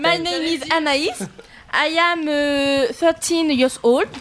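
slow, careful schoolkid English; lightly played classroom English; French-accented English. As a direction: recite it like a shy pupil. Pupil presents herself in English.

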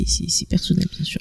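soft whispered aside. Real, not acted. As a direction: drop to a whisper for it. Aside about personal taste.

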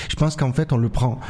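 ordinary delivery

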